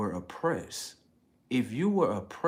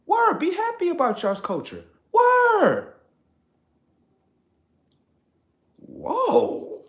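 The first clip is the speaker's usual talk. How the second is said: very high, louder laughing; laughing squeal; 9 dB louder, slower than usual. Real, not acted. Wrapping up the rant, very high laughing. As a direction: crack up in a squeal.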